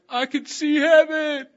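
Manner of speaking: very high, loud and slow; acted excited squeal. Telling her friend of heaven, excited squeal. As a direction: squeal it out, hammed-up with amazement.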